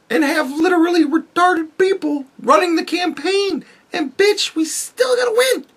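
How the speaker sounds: high, rising to a shrill squeal at the end, mock gloating; mocking gloating officials. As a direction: play a smug insider crowing that nothing can stop them. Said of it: "Just so that they can show us".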